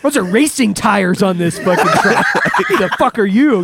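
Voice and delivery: high, loud mock outrage breaking into laughs; indignant outburst. Gunner telling off the guy who called them MPs, indignant. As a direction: retell an outraged comeback, amused. Indignant retold comeback to insult.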